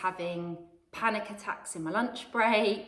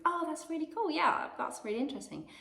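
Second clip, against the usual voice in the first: high, polite, keen reply; polite enthusiasm; 9 semitones higher than usual. Retold or imitated. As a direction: sound politely interested. Polite reply to old lady.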